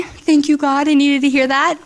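mildly tearful thanks to God; retold tearful gratitude. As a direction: relive a grateful cry, voice lifted. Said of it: "of course, I'm crying".

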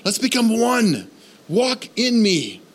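high, slow pleading prayer; fervent prayer. Acted. Pastor modelling a prayer for God's kingdom, fervent and slow. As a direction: pour out the longing to God.

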